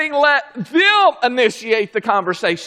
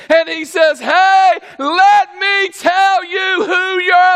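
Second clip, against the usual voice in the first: loud, high, ringing sermon voice with shouted peaks; preaching at full force; 8 semitones higher, 6 dB louder than usual. Acted. Preacher shouting to the congregation, fiery full-force preaching. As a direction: drive it home like a revival preacher.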